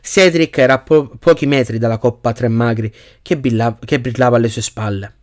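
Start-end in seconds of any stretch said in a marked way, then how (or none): none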